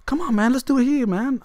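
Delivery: high mock voice, pushy; high mimic of the man pressing to stop here; impatient stranger. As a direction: whine it like an impatient creep.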